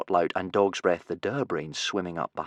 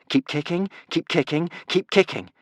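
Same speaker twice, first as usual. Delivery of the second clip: urging on; 8 semitones higher than usual. Acted. Boy urging friends to keep swimming.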